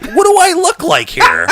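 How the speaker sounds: high, loud mock indignation; incredulous. Teller taken for a cocaine buyer, loud incredulous outburst. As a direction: exclaim it way up high, comically affronted.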